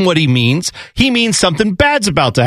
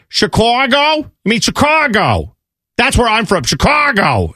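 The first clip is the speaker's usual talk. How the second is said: higher, broad hometown Chicago vowels; exaggerated Chicago accent; 6 semitones higher, slower than usual. Chicago local insisting on their hometown's name, broad accent. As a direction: imitate a proud local overdoing the Chicago accent. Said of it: "go out of their way to say".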